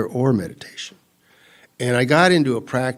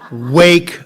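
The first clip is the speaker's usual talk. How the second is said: calling wake up; 3 semitones higher, 9 dB louder than usual. Retold or imitated. Punchline call to wake up.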